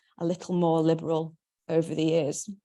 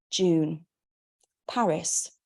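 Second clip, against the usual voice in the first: usual speaking voice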